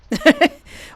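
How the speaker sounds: bursts into a high giggle; can't hold back a giggle; real high giggle. Real, not acted. Reader cracking up at the script, giggling. Laughs at the line.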